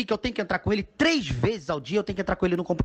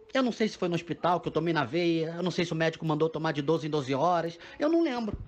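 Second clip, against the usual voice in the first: normal talking voice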